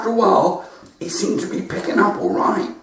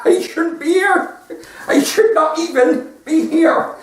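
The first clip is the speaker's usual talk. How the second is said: put-on higher, slow, laughing old lady; 12 semitones higher, slower than usual. Distraught old lady telling the nurse, higher and slow. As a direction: play her as a giggly frail old woman.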